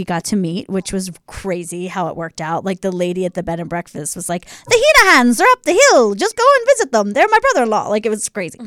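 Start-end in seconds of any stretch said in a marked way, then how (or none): 4.7-7.9 hammed-up Irish accent, higher and louder; Lucky Charms Irish accent. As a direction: do the B&B landlady in an over-the-top Irish accent. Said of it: "that's my horrible Lucky Charms accent".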